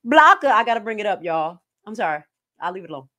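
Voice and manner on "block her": high, loud mocking call; mock loud order. Host mimicking people who blocked her, loud mock shout. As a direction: fling it out like a command. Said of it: "Like they said".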